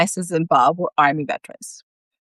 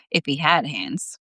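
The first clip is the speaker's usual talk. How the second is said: ordinary delivery, nothing marked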